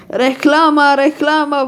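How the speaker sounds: loud excited call. A narrator's reading. Shoppers loudly calling out at the speeding toy car. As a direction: shout it to the crowd. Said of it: "wołali kupujący".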